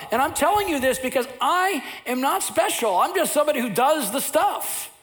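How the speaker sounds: high, loud passionate preaching; full-force preaching. Acted. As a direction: preach it out to the whole room.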